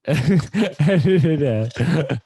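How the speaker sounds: laughing through it, drawn out slowly. Real, not acted. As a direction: giggle while repeating the request. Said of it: "N-, edit it out".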